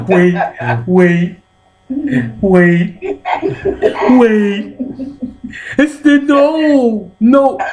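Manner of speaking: high, loud, hammed-up begging to stop; panicked pleading. Man begging his partner to wait, high panicked squeal.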